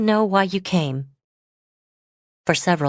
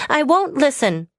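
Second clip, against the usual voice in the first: upset refusal; 10 semitones higher than usual. Acted. Autumn refusing again.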